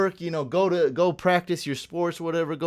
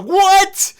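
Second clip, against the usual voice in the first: squeaky high, loud disbelieving shout; shocked what; 12 dB louder than usual. Retold or imitated. To the drive-thru worker who has sandwiches, a high stunned shout. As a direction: blurt it out, stunned. Hears there are sandwiches after all.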